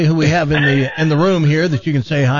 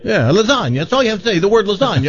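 higher-pitched hammed-up Italian accent; awful Italian accent; 5 semitones higher, faster than usual. Host scolding [name]'s accent on request, hammed-up Italian accent. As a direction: protest in a bad stage-Italian accent. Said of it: "break into your awful Italian Bertorelli accent".

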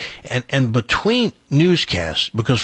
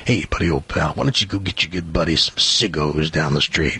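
much lower, booming buddy-buddy baritone; commanding voice; 9 semitones lower than usual. Retold or imitated. Newsman ordering the teen intern to fetch cigarettes, deep commanding voice. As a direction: lay on the deep boss charm. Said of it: "in that commanding voice".

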